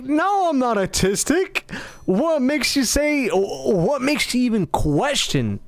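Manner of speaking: high, slow mock-offended protest; indignant denial. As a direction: push back, drawing out the challenge.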